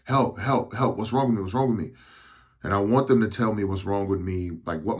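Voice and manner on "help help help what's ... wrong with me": panicked cry for help. Retold or imitated. Injured performer begging crew what's wrong, panicked. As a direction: call for help like you're scared and dizzy. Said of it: "I'm like".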